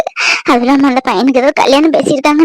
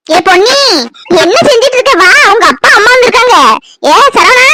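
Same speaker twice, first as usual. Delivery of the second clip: calling out; 6 semitones higher than usual. Acted. Mother calls children to visitors.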